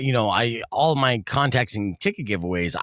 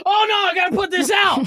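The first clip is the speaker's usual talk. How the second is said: high, loud panicked freak-out; 5 dB louder than usual. Retold or imitated. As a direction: yelp it out in a panic. Arsonist firefighter acting out panic.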